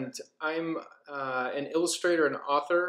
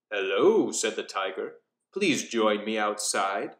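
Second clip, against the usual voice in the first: calm, lower and velvety-smooth big cat; a tiger coaxing a girl outside, lower; smooth lower predator invitation; 5 semitones lower than usual. Acted. Tiger character speaks.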